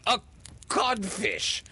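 very high echoed exclamation; stunned echo. A narrator's reading. Hook repeating the insult thrown at him, stunned. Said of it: "Hook echoed".

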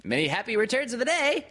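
very high, loud, quick little-creature voice; excited squeaky well-wishing; Piglet. A narrator's reading. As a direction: pipe it out fast and piercingly. Piglet's birthday greeting to Eeyore.

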